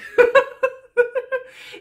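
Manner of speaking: big high burst of laughter; loud laugh. Real, not acted. Laughing hard at her own Bachelor joke, loud burst. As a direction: crack up loudly.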